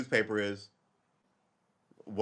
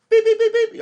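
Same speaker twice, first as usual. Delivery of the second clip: high, loud beeping like a cockpit warning; imitating a warning tone, loud and high; imitated alarm beeps; 14 dB louder than usual. Shown as a demonstration. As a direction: beep out the alarm sharply. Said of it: "And it goes like this".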